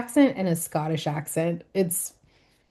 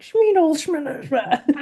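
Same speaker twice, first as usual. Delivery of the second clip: high mock-German gibberish; 8 semitones higher, 3 dB louder than usual. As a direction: start high and garble fake German. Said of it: "then it gets to German".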